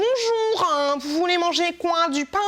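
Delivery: mock baker voice. Imitating her baker greeting customers in a high prissy voice. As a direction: ham up a prissy baker serving you. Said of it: "une boulangère qui me parle comme ça".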